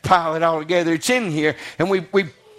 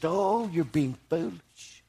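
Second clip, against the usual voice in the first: softer tender scolding of foolish disciples; compassionate rebuke; 7 dB quieter than usual. Retold or imitated. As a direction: chide them gently, like a sorry friend. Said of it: "He was compassionate".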